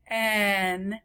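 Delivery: ordinary delivery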